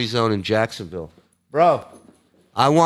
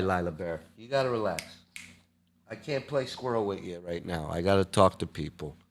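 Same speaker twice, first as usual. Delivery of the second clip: soft to the dog; 4 semitones lower, 12 dB quieter than usual. Real, not acted. Talking softly to his dog.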